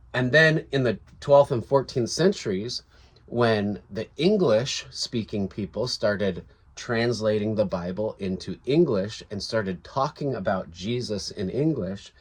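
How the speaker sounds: ordinary delivery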